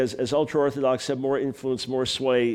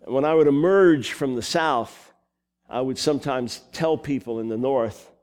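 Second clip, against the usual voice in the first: ordinary talk, nothing marked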